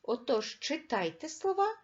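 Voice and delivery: usual speaking voice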